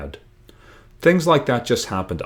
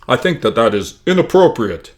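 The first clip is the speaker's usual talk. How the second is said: a light imitation of a stern principal, louder; mock principal scolding, lightly voiced; 3 semitones higher, 8 dB louder than usual. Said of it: "perfect imitation of our principal".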